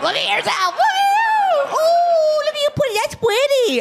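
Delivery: roasting a dead friend at her open casket, mock falsetto; mocking praise. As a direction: coo sarcastically over the corpse. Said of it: "not to be like".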